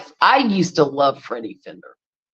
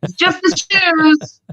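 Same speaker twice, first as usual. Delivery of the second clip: imitating daughter; 8 semitones higher, slower than usual. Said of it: "she's like".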